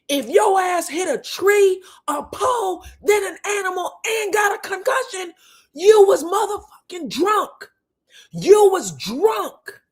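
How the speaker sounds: high, loud, imitated screaming; bullhorn scream. Retelling Martell standing up at dinner, screaming like a bullhorn. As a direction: holler it as if through a bullhorn. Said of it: "screams like he had a bullhorn".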